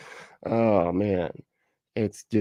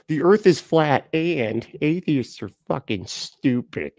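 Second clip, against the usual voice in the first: slow, high-pitched mocking voice; mock flat-earther; 6 semitones higher, slower than usual. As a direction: drag it out as a dim flat-earth believer. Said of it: "He's like".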